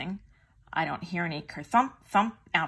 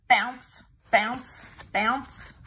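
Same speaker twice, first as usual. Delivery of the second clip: higher, louder bouncy sound words; bouncing sound effect; 5 semitones higher, 5 dB louder, much slower than usual. A narrator's reading. Narrator mimicking the tiger bouncing, loud and higher. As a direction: bounce each word like a ball.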